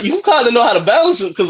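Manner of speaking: ordinary talk, nothing marked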